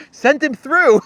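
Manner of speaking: eager, high and loud "send him through"; mock Pompeo. Lightly voiced Pompeo telling the secretary, eager and high. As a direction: play the gullible official, keen.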